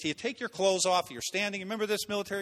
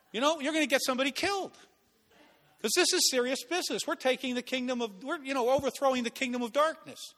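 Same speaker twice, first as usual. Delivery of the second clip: ordinary delivery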